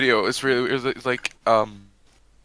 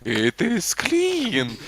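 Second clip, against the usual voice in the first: put-on very slow, high Russian accent; 10 semitones higher, much slower than usual. Imitating the Russian cleaner, drawn-out Russian accent. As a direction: stretch each word in a mock Russian accent. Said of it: "speaking this Russian accent".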